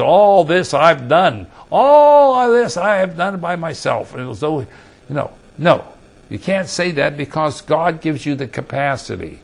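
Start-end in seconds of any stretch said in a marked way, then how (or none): 0.0-4.1 boastful king. Retold or imitated. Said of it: "he said".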